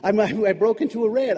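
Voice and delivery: ordinary delivery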